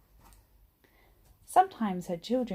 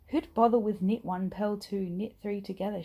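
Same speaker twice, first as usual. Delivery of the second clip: ordinary delivery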